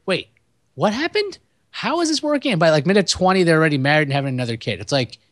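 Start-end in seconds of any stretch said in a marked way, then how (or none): 0.1-2.5 mock baffled viewer. Mocking viewer confusion at pacing.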